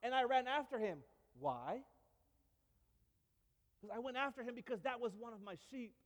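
put-on boy telling a wild story; excited David. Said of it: "He goes".

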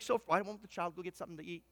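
quiet, fast puzzled mutter; softly baffled, hurrying through it; puzzled parents. Acted. Parents puzzled by crying baby.

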